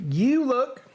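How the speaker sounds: high-pitched Southern drawl; Shakespeare in a Southern accent. Acted. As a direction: launch into a theatrical Southern accent. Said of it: "read now in a Southern accent".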